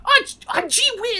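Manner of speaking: very high cartoon-mouse gushing; Mickey Mouse voice. Host mocking Tom Holland's Spider-Man, Mickey Mouse voice. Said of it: "He's, he's Mickey Mouse".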